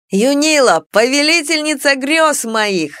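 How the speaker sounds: loud, slow adoring cry; exclamation. Acted. Dragon hails the heroine with a loud adoring cry. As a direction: call out her name in rapture. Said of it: "услышала я восклицание".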